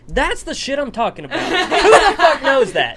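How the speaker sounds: high, loud, laughing triumph; excited laughing outburst. Real, not acted.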